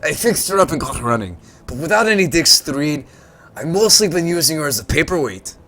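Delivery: put-on a well-meaning old salvager, hamming it up; gruff old tinkerer. Old salvager introduces himself to the visitor, gruff and friendly.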